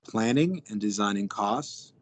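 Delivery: normal talking voice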